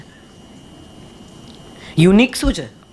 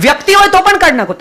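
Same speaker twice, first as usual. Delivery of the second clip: much higher, louder and fast, pressing the point hard; emphatic command; 9 semitones higher, 5 dB louder, faster than usual. Real, not acted. Speaker urges the audience to drop even people, in a loud emphatic voice. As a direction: ram the point home with force.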